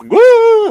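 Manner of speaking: sudden loud high woo; a jumpy startled shriek; startled yelp. Real, not acted. Startled jump mid broadcast.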